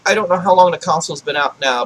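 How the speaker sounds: ordinary talk, nothing marked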